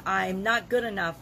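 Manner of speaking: ordinary talk, nothing marked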